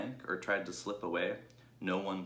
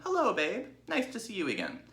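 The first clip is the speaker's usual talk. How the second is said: higher, louder doting ewes; fond sheep crying out; 14 semitones higher, 4 dB louder than usual. Acted. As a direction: coo over the little pig. Sheep greet Babe.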